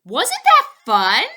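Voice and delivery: loud cheery question; delighted Fillmore. A narrator's reading. Fillmore asking Poppleton about the sail, loud and cheery. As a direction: beam with glee.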